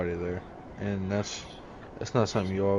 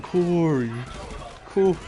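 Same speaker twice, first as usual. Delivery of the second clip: calling Corey; 7 semitones higher, slower than usual. Retold or imitated. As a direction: whine for Corey again. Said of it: "He'd be like".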